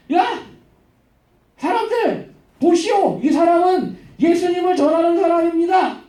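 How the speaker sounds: high-pitched public proclamation, acted out; crying out. Slave girl following Paul announces him to everyone, crying out. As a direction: call it out to the whole crowd. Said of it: "뒤에서 따라다니면서 외쳐요".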